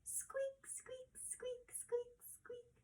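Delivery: high, soft mouse squeaks. Shown as a demonstration. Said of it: "Let me hear".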